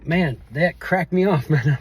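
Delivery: real tears catching in the throat; voice breaking. Real, not acted. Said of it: "I don't know why I'm so emotional right now".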